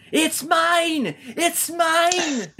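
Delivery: very high-pitched mock Mexican-accented taunt; thick Mexican accent. Retelling the new champion taunting a fan, thick Mexican accent. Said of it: "with his thick Mexican accent".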